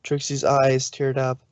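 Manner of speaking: plain reading voice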